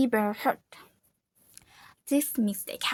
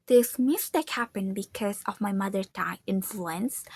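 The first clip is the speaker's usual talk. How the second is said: ordinary talk, nothing marked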